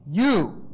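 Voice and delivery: "You." hit hard and alone; emphatic you. Shown as a demonstration. Preacher stressing Caiaphas's "You." to his hearers. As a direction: punch the single word "You.". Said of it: "This is very emphatic".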